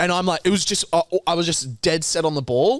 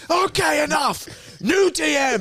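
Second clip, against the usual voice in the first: exasperated coach shout; 13 semitones higher than usual. Retold or imitated. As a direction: coach ending the drill in frustration.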